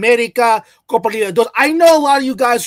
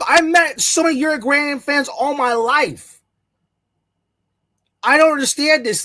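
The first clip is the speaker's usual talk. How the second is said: normal talking voice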